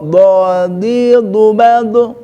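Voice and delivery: very slow bodi dubal, heavy b; benar bodi dubal. Shown as a demonstration. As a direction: drag out bodi dubal, dark vowels. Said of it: "Bukan dadi dubade". (bodi dubal pelan dan tebal)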